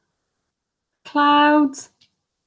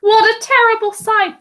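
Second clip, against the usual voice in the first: usual speaking voice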